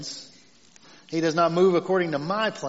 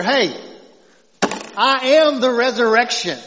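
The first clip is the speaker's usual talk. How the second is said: a firm, louder voice of Jesus declaring; 4 semitones higher, 6 dB louder than usual. Acted. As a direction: proclaim it as Jesus would, with weight. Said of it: "You hear, you hear that in his voice?".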